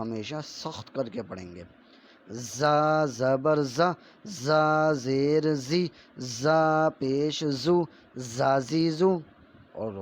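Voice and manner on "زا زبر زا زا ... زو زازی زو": slow, loud sing-song recitation of letter sounds; syllable drill. Shown as a demonstration. Teacher drilling children in Urdu letter sounds, slow and loud. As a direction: chant each syllable slowly like a classroom primer.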